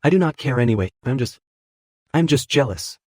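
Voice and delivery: choked voice. A narrator's reading. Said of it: "her voice choked".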